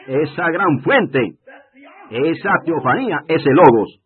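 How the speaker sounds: slow, building to a loud cry on logos; swelling sermon cry; rising preaching climax. Real, not acted. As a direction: preach it up, each phrase heavier.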